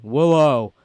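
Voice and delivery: loud, drawn-out call of a name; imitated calling out. Imitating boyfriend outside calling for cousin, loud drawn-out holler. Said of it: "all of a sudden we heard".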